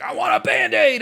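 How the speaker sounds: high, loud shouted demand for a Band-Aid; toddler's demand. Retold or imitated. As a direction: yell it like a stubborn toddler. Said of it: "she's like".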